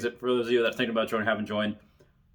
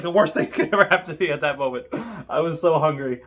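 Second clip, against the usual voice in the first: ordinary delivery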